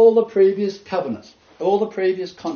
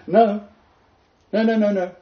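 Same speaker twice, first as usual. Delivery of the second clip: emphatic denial; 8 semitones higher, 4 dB louder than usual. Real, not acted. Emphatic rejection of Adventist claim.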